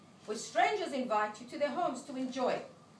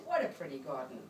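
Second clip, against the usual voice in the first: lightly voiced tourist, much lower; a hint of an admiring sigh, pitched down; 10 semitones lower, faster than usual. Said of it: "The tourists sigh and say".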